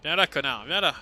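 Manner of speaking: loud angry taunting. Real, not acted. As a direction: shout at the enemy. Taunting the boss.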